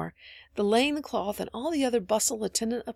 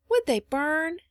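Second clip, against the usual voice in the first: small anxious question, pitched up; 8 semitones higher, 4 dB louder, slower than usual. A narrator's reading. Phronsie asking Polly about candles, anxious. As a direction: ask it high, with a touch of worry. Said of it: "asked Phronsie anxiously".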